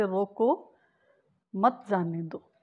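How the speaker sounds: "रोको" held on its own, clear pause, then "मत जाने दो" as one falling phrase; pausa dopo "रोको". Shown as a demonstration. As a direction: break right after "रोको", keeping "मत" with "जाने दो". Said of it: "अब हम इसको कैसे पढ़ेंगे?".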